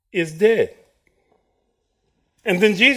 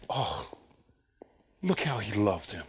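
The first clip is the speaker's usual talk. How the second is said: mock hushed mourners; 9 semitones lower, 12 dB quieter than usual. Mourners voiced.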